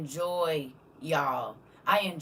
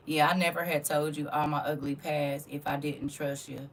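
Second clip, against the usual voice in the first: ordinary delivery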